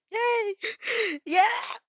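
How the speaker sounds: high, loud cheer; exclaiming. Acted. Friends cheering because they can stay, high excited shout. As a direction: burst out cheering. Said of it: "you exclaim".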